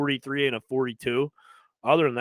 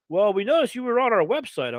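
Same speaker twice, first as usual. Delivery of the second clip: put-on country accent; 7 semitones higher than usual. Carter quoted on phone.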